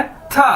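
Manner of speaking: था modelled high for learners; Chinese tā. Shown as a demonstration. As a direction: say था high and even, twice. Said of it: "इसे बोलना कैसे है".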